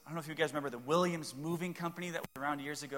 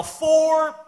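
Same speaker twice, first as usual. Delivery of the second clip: loud, high shout of Four; a full-voiced warning shout on the golf course; golf yell; 11 semitones higher, 13 dB louder than usual. Shown as a demonstration. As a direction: bellow it across the course. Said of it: "I yell".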